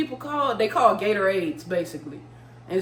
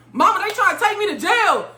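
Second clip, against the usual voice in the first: high-pitched yell to mama; mock yell; 9 semitones higher, 4 dB louder than usual. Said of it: "I said, and I yelled".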